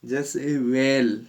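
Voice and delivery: वेल drawn out as "well"; slow model word. Shown as a demonstration. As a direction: stretch वेल slowly for the class. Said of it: "ए से उच्चारण".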